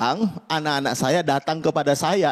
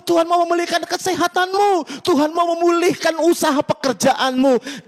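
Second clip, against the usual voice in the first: full-force preaching; 8 semitones higher than usual. Acted. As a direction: preach it to the back row, rising in pitch and volume with every promise. Altar call at full force.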